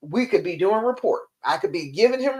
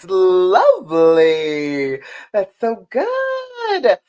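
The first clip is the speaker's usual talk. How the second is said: warm praise; 6 dB louder, much slower than usual. Acted. Praise after breathing exercise.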